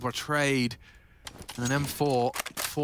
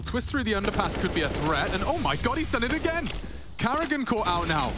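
rising hype call, pitch climbing; excited; 7 semitones higher than usual. Real, not acted. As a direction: build to a big hype scream. Caster hypes a clutch play.

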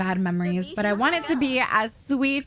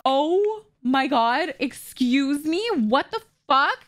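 drawn-out, slow, higher exclamations; shocked disbelief; 4 semitones higher, much slower than usual. Real, not acted. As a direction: stretch each phrase in appalled disbelief. Appalled by fire in game.

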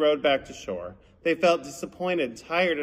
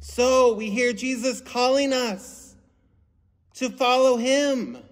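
higher, slower and louder, drawn out; emphatic recap; 8 semitones higher, 4 dB louder than usual. Shown as a demonstration. Pastor stressing the theme to children, slow and emphatic. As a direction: lean in and drive the message home.

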